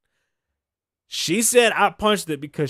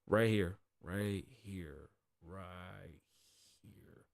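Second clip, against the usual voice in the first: each right here softer until it vanishes; fading out; 8 semitones lower, 13 dB quieter, much slower than usual. Acted. Plugging his Patreon, fading into silence. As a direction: let the plug die away to nothing. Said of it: "fading plug, voice dying away".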